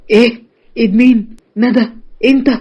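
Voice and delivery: a stern father who can't believe his ears; shocked father. A narrator's reading.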